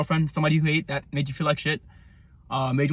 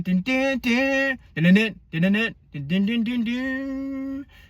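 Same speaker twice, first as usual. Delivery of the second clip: high sung dun-dun guitar imitation; vocal guitar solo; 7 semitones higher, slower than usual. Fan mimics the guitar solo with his voice, sung "dun dun". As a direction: hum the solo riff with "dun". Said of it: "Then there's a solo".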